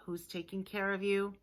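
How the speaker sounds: ordinary talk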